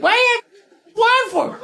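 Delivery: very high indignant duck squawk; high-pitched cartoon duck protest; squawking duck wife.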